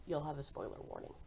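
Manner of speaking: ordinary talk, nothing marked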